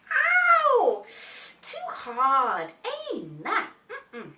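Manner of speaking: loud high "ow", then dropping to a grumble; yelp, then turn the bed down; displeased Goldilocks. Acted. Goldilocks tries Daddy's hard bed, displeased.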